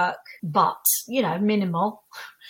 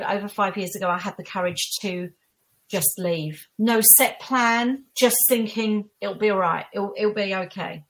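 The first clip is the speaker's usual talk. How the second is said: ordinary talk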